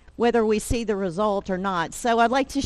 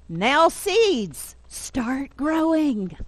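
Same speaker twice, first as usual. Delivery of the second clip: higher, drawn-out storybook command; Toad commanding seeds; 5 semitones higher, much slower than usual. Acted. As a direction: coax the seeds firmly, stretching the last words.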